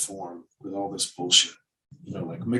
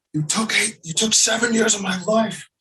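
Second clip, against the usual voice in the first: much higher, loud dramatic movie outburst; Al Pacino delivery; 13 semitones higher, 5 dB louder than usual. Acted. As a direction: hurl it like a wronged man in a courtroom scene. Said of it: "deliver it like an Al Pacino".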